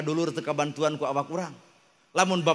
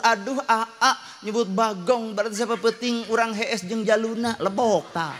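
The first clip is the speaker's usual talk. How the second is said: wife's voice; 9 semitones higher, 4 dB louder than usual. Acted. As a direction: coax the husband in a high wife's voice. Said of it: "Untung pamajikanana pinter syukur". (pamajikan ngarayu salakina, sora luhur tur lalaunan)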